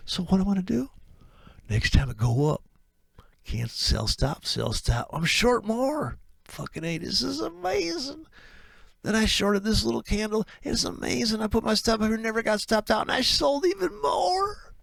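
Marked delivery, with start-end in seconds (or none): 5.1-14.7 rising glee to a yell; bragging trader. Acted. Trader brags, ends in shout.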